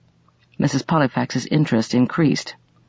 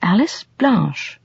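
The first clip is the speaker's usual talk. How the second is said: musing recollection; 5 semitones higher, slower than usual. A narrator's reading. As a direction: try the name over as memory stirs. Listener muses, memory stirring.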